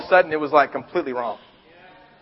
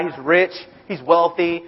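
ordinary talk

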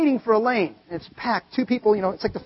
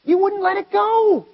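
God's lament; 8 semitones higher than usual. Acted. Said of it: "God going".

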